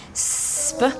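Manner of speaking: teacher and class slide through the word split together; sounding out. Shown as a demonstration. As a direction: split started slowly on its first sound. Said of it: "Can you all practice it with me".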